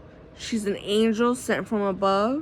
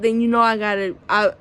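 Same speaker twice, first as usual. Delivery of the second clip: ordinary talk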